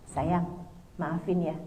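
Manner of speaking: low, soft husband's plea; acted gentle apology. Husband suddenly apologises to his wife, low and gentle. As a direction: voice a husband saying sorry softly. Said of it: "tiba-tiba suami bilang".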